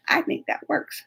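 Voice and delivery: breathy aside under her breath; half-whispered private aside. Real, not acted. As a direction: whisper it to yourself. Aside to herself, hushed.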